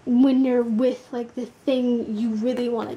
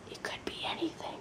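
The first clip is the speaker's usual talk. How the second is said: whisper; 16 dB quieter, faster than usual. Acted. Unvoiced whisper mid reading.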